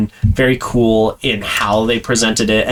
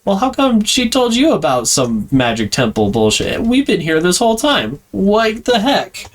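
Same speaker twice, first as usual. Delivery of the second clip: high, whiny complaint climbing up; indignant hunters; 9 semitones higher than usual. Retold or imitated. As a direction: gripe like jealous rivals. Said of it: "where they're like".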